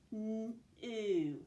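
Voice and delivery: "N-oo" stretched, a long hummed n then a long oo; sounded out. Shown as a demonstration. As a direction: sound out "N-oo" slowly, sound by sound. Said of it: "Let's sound out that first syllable".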